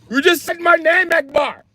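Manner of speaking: put-on high-pitched, outraged Indian accent. Robber scolding his accomplice, high mock Indian accent. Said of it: "getting robbed by an Indian guy".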